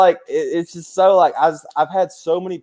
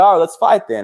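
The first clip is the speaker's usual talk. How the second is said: mock challenge; 6 semitones higher than usual. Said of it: "I was like".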